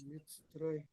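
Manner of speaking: faint voice testing the line; barely audible. Real, not acted. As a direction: speak almost too softly to hear. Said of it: "your voice is very low".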